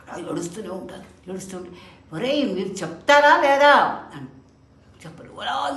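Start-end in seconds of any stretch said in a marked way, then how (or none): 2.1-3.7 loud, much higher demand; exasperated guru. Acted. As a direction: snap at the boys to answer. Guru loses patience.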